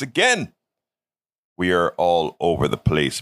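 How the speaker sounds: usual speaking voice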